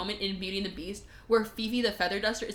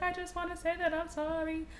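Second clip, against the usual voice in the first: higher, softly voiced apology; meek acted apology; 6 semitones higher, 8 dB quieter than usual. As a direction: say sorry in a small, high voice. Acting out a timid apology.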